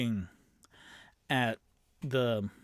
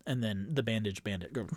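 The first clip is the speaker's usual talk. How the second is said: ordinary talk, nothing marked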